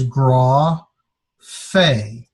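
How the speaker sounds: gra and fe apart, each held; slow, separated gra, fe; syllable by syllable. Shown as a demonstration. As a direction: sound out gra, pause, then fe. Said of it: "this is the R sound".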